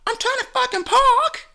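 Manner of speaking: high put-on whiny voice; mocking the other driver. Mocking the other driver's complaint, high whiny voice. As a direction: mimic him high and whiny. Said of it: "He's like".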